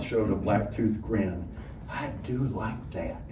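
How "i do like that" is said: quiet pleased purr. A narrator's reading. Honeybell purring over the guest's name. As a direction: savour it with a sly little laugh.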